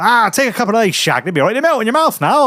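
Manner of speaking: high, quick mock voice of a mate urging pills; mocking a pushy mate. Mates pushing Imodium on him.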